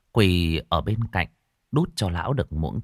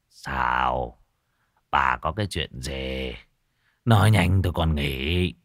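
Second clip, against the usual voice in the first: lower, faint put-on sick rasp; feeble sickly voice; 6 semitones lower, slower than usual. Old husband feigning illness to his wife, lightly feeble. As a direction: lightly play a weak dying old man. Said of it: "Lại dở cái giọng thều thào ốm yếu".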